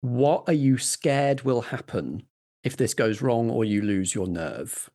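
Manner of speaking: ordinary talk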